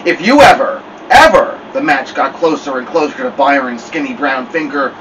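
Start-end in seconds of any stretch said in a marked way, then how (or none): 0.0-1.6 loud, menacing mother; Snake Woman voice. Acted. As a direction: threaten like a snake ready to strike. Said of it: "Snake Woman voice came out".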